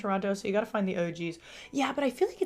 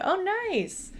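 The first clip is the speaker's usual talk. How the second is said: excited; 12 semitones higher than usual. Real, not acted. Reacts to viewer.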